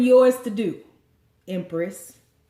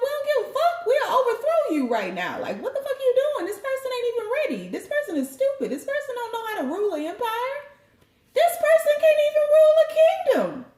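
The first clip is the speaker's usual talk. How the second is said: mocking crowd; 14 semitones higher, faster than usual. The people mock the Empress in a high, laughing, rising voice. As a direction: cackle through the mob's insults, mostly fast. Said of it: "They was like".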